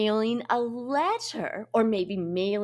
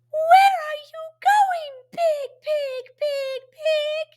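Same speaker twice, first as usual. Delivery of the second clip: very high chanted question; 6 dB louder than usual. Shown as a demonstration. Teacher leading children in the big pig poem, high voice. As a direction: call it out way up high. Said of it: "we use a high voice".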